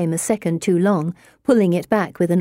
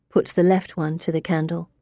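ordinary delivery

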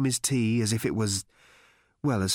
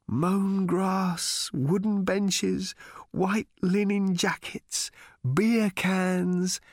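savouring; 8 semitones higher, much slower than usual. A narrator's reading. Arthur savouring England, dreamily.

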